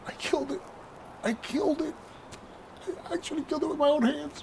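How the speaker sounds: high, teary imitation of a sobbing friend; retold crying. Roommate voiced grieving over the dead mouse, high mock-tearful. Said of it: "and he's crying".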